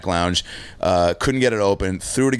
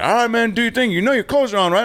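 much higher, worried roommate voice; concerned buddy; 14 semitones higher than usual. Retold or imitated. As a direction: play the friend checking on you through the door. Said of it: "He's like".

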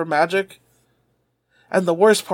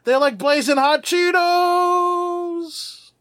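strained high voice; 9 semitones higher, much slower than usual. Acted. Joking about hot panties.

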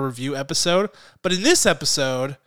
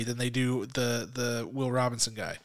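whispering; 4 semitones lower, 9 dB quieter than usual. Real, not acted. Aside after robot talk.

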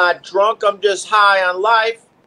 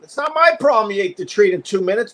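normal talking voice

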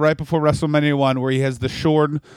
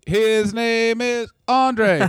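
slow, grand trailer narrator; dramatic announcer; 6 semitones higher, much slower than usual. Acted. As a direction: build it up like a movie trailer. Mock show intro continued.

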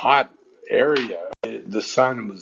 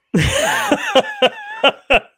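high cackle of laughter; 4 dB louder than usual. Real, not acted. Laughing at the punchline.

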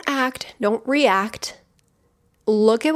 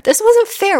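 much higher, louder, near a wail; second take, bursting out; child's outburst; 13 semitones higher, 5 dB louder than usual. Retold or imitated. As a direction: let the child cry out. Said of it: "that little kid you that's just like".

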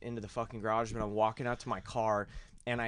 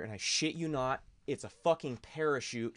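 ordinary delivery

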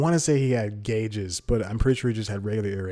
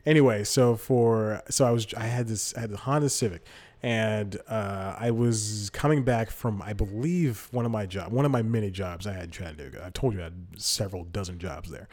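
usual speaking voice

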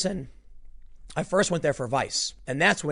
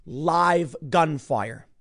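very slow, weighty delivery; dramatic slow emphasis; much slower than usual. Real, not acted. Telling of Ferguson riots, slow dramatic emphasis. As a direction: stretch each word for drama.

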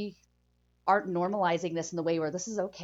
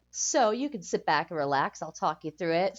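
normal talking voice

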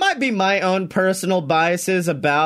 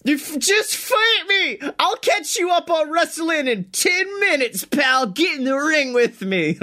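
much higher, whiny mocking taunt; mock taunting Seth; 8 semitones higher than usual. Mocking a wrestler's tweets, high whiny taunt. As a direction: parody a wrestler begging for a fight. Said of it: "Seth Rollins is like".

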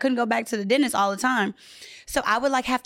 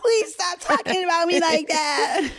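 pleading; 6 semitones higher, slower than usual. Retold or imitated. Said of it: "But I'm like".

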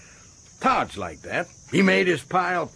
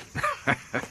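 short, soft breathy laugh; amused chuckle; 7 dB quieter than usual. Acted. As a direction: chuckle softly at your own remark. Chuckles about Todd's character.

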